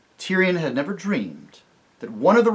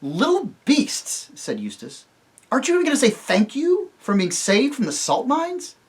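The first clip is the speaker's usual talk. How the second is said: put-on indignant boy; 11 semitones higher, 4 dB louder than usual. Eustace scolds the ungrateful dwarfs, indignant. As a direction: scold them in disgust.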